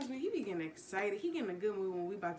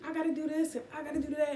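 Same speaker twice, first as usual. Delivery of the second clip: higher, fast mock complaint; higher, quick mocking back-talk; mock angry wife; 7 semitones higher, 4 dB louder, faster than usual. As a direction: play the snippy wife talking back.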